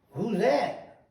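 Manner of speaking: high-pitched imitation of a surprised promoter; retold startled question. Promoter spotting the man reaching for contracts, startled question. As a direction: pitch it up sharply, as if caught out. Promoter spots the unexpected attorney.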